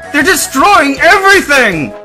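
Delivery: high, frantic full-force shout; yelling. A narrator's reading. Man tells Ash of the destruction, yelling frantically. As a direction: yell it in despair. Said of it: "he yelled".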